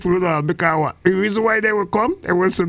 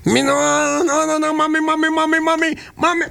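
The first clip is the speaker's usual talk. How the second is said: put-on pleading boy; 7 semitones higher than usual. Boy refusing in acted scene.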